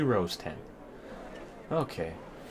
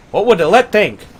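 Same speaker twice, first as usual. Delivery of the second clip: loud mock outrage; scolding; 6 semitones higher, 10 dB louder than usual. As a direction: berate them like a disappointed parent.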